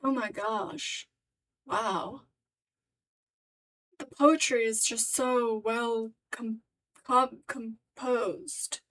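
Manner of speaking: slow, halting seductive act; hammed-up sultry admiration; mock sexy. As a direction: draw it out with put-on allure. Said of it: "Sorry, sexy?".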